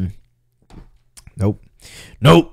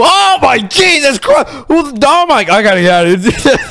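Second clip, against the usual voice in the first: startled panic; 10 semitones higher than usual. Real, not acted. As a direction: blurt it out in sudden fright. Startled by something in game.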